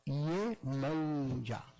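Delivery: "Iemanjá" slow, with a throaty J; Yoruba pronunciation. Shown as a demonstration. Teacher showing how the Yoruba say the orixá's name. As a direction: say "Iemanjá" slowly with a guttural J. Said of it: "Olha o J gutural".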